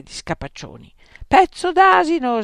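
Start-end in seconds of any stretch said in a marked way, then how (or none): 1.3-2.4 much higher and louder angry bawl; father bawling out his failed son. Acted. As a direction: yell at your son.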